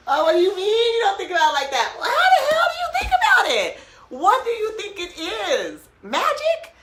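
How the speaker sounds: high, loud, laughing disbelief with a fast start; incredulous disbelief. Retold or imitated. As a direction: laugh it out like you can't believe what you just heard. Mocking her seatmate's reply.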